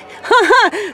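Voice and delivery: high, loud burst of laughter; sudden high giggle; amused laugh. Real, not acted. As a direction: crack up laughing at your own slip. Amused at own fumbled word.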